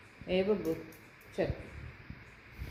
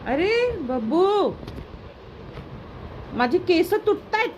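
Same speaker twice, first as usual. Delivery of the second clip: pained yelp; 10 semitones higher, slower than usual. Real, not acted. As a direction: yelp as hair gets pulled. Dog pulls her hair. (दुखून ओरडणे)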